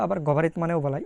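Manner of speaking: normal talking voice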